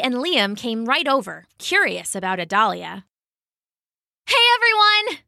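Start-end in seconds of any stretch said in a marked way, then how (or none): 4.3-5.2 high, louder greeting to a group; calling out. A narrator's reading. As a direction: call brightly across the yard, high-pitched. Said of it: "Isabella called".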